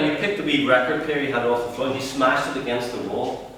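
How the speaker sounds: usual speaking voice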